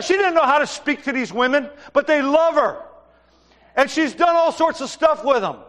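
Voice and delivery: full-force preaching. Acted. Pastor urging his church to step up, full-force preaching. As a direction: preach it out with rising urgency.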